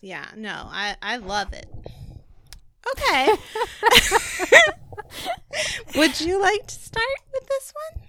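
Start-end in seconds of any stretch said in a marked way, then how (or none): 2.9-8.0 high laughing voice. Real, not acted. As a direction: still giggling while asking. Laughing after the accent joke.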